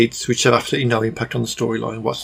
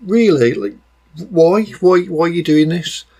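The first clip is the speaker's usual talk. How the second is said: higher-pitched, incredulous questioning; exasperated disbelief; 6 semitones higher than usual. Acted. Reviewer questioning the mum just standing there, exasperated disbelief. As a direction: demand a reason, pitched up in disbelief. Acted exasperated thought at the film.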